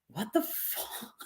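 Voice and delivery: a stunned, breathy whisper. Real, not acted. Stunned viewer whispering at a shocking comment. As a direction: whisper it in disbelief, slowly.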